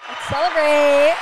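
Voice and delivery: loud, slow celebratory cheer. Real, not acted. Cheering success.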